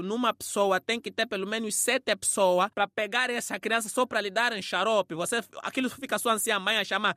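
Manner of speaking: ordinary talk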